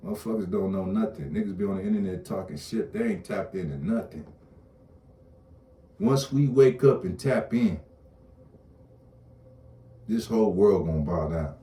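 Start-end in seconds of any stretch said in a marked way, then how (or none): 6.0-11.6 loud and higher, preaching hard; rally the crowd with it; declaring it at full voice; fired-up shout. Real, not acted. Preaching about waking up.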